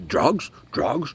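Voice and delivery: imitated colonel with a Ukrainian accent; Ukrainian-accented broken English. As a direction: demand it in a thick Ukrainian accent. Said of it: "he hardly speaks any English".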